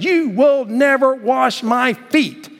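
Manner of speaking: high, slow protest. Acted. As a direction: plead it high and drawn out. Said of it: "Peter protested".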